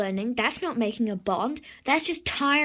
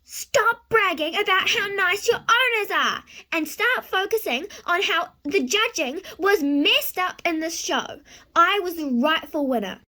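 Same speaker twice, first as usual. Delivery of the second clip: high, loud, indignant yelling; 6 semitones higher, 5 dB louder than usual. Acted. Jealous Lily cuts off horses bragging about owners, yelling. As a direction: yell over them to shut down the bragging. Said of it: "there's no need to yell".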